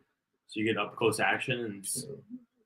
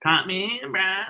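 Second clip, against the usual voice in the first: high, squealing cartoon impression; Cartman; 9 semitones higher, much slower than usual. Doing a cartoon impression for a co-host, high squeal. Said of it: "Carmen impression".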